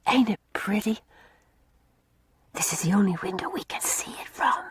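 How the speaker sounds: soft unvoiced whisper; hushed so no one hears in the dark. A narrator's reading. Una whispering to Mary at the garret window.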